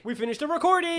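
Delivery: high celebratory call; excited cheer. Real, not acted. Co-host celebrating the finished recording, excited cheer.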